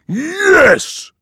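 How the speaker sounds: very high, loud gleeful giant cry; dopey cartoon bellow. A narrator's reading. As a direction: squeal it with dopey giant delight. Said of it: "says the giant".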